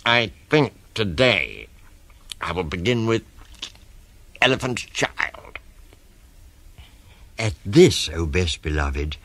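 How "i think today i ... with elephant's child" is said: between the teeth. Acted. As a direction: keep the jaw clamped, spacing out phrases. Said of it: "said it between his teeth like this".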